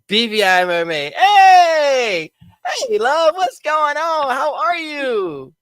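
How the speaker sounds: high, slow showman announcement; high, drawn-out emcee intro; grand introduction. Real, not acted. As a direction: present the star like a ringmaster. Introducing the guest star.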